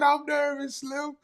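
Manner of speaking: high mock-nervous voice; mock nervous squeak. Teasing a nervous viewer by parroting him in a high mock-nervous voice. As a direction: squeak it out like a jittery fan. Repeats comment in mocking voice.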